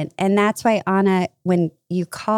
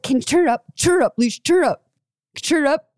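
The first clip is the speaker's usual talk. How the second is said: mock nagging in a much higher voice; insistent nagging; 9 semitones higher than usual. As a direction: badger her to turn it up, hammed up. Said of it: "you said".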